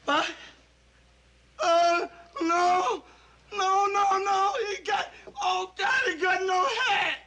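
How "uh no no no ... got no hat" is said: horrified sobbing. Acted. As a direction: beg through sobs over a dead friend. Sees dead friend's head.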